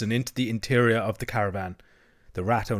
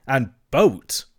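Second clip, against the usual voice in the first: "boat" tossed out with disdain; dismissive; 2 semitones higher, 5 dB louder than usual. Acted. Toad belittling Rat's river life, dismissive. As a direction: make "boat" sound tedious.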